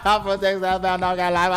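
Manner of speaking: loud garbled shouting through laughter; imitated TikTok college girls. Host mimics girls naming colleges, loud mock yell. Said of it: "girls were naming their colleges".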